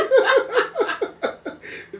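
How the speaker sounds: loud high cackle; helpless shrieking laugh; high cackling laugh. Real, not acted. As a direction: burst out cackling. Big laugh.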